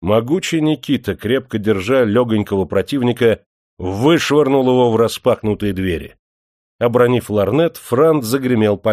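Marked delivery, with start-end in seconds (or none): none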